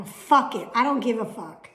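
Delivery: loud fed-up swearing; angry outburst. Retold or imitated. Giving up on the video visit, loud and fed up. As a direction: blow up and quit. Slams laptop, quits call.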